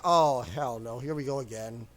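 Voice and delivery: low, quiet groan of resignation; weary muttered dread. Retold or imitated. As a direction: mutter it to yourself, sinking away. Retold dismayed reaction to scam call.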